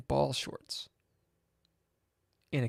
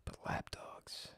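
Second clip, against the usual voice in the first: whispered aside; 16 dB quieter than usual. Real, not acted. Disgusted aside about lap dogs.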